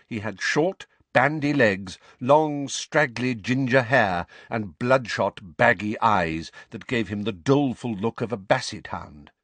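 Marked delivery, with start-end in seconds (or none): none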